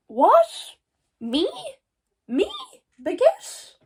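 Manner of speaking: high rising questions; high, drawn-out who-me; mock incredulous. Real, not acted. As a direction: act stunned it is you. Disbelief at her own luck.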